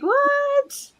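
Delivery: high-pitched, drawn-out mock-baffled "what". Host recalling confusion over the dip master question, mock-baffled. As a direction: replay your confusion with a high, questioning squeal. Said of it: "I'm like".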